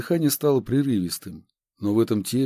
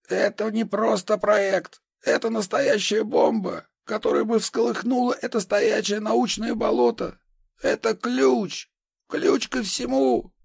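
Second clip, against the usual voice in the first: manic insistence; 12 semitones higher than usual. Acted. Madman insists on project.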